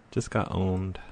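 quiet mutter. Real, not acted. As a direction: mutter it, deflated. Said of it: "I just got owned".

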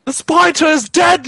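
high yelled announcement; shouted prank line. Pretend. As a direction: yell it up high.

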